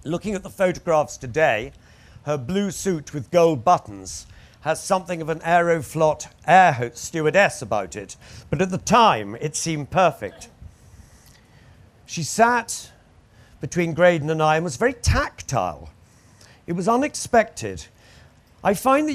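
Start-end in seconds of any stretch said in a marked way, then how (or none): none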